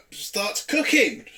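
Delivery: "starts cooking" drawn out slowly; "starts cooking" stretched out slowly in disbelief. Shown as a demonstration. Repeats phrase for disbelief.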